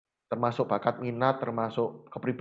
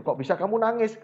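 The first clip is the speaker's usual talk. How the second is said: higher, startled asking why she cries; surprised question; 8 semitones higher than usual. Retold or imitated. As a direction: retell your own surprise, pitch jumping up. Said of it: "karena saya kaget". (kaget, nada naik tinggi)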